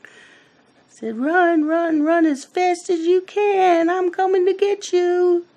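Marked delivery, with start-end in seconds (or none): 1.2-5.5 very high, teasing chase voice; playful chasing taunt. Retold or imitated. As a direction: taunt a toddler as the pretend monster. Playful chase game with toddler, retold.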